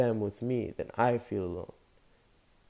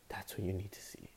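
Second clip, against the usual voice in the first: whisper; 10 dB quieter than usual. Real, not acted. Telling listeners they need to see him cry, whispered. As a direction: drop to an intimate whisper. Closing point dropped to whisper.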